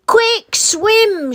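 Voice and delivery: high, loud urgent cry to swim; urgent shout. A narrator's reading. Rossi urging Gasper to swim off the iceberg, shouting. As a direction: yell it as the ice breaks away. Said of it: "shouted Rossi".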